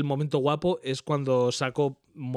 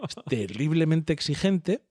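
ordinary talk, nothing marked

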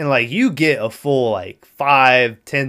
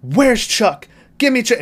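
high-pitched mock agitation; retold frantic demand; agitated demand; 10 semitones higher than usual. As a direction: demand it high and urgent, imitating a movie character. Acted agitated demand in film retelling.

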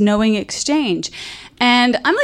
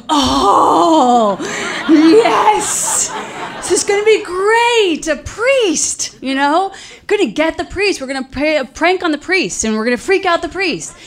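put-on high, thrilled kids' cheer; squealing with childish glee; excited kids; 5 semitones higher than usual. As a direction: gush like giddy kids plotting a prank. Kids thrilled about priest prank.

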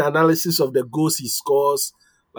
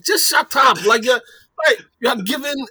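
higher exasperated "shut up"; mock exasperated yell; 7 semitones higher than usual. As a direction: snap it like you're fed up. Retold annoyance at Ibrahimovic.

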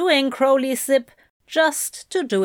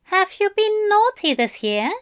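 higher, playful teasing voice; teasing Aziraphale; 11 semitones higher than usual. A narrator's reading. Aziraphale teasing Crowley in a lilting voice. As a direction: tease him like a coy Santa.

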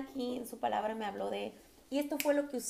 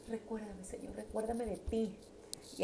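quiet prayer; 5 dB quieter than usual. Retold or imitated.

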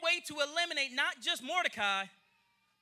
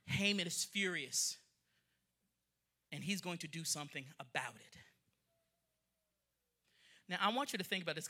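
ominous hush; 10 semitones lower, 11 dB quieter than usual. Real, not acted. Preacher warning the congregation about Haman, hushed and ominous. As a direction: drop low and soft.

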